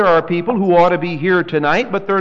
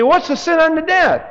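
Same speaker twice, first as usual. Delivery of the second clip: mock questioner; 10 semitones higher than usual. Voicing a questioner in the congregation.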